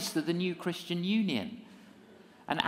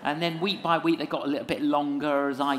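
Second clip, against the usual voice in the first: usual speaking voice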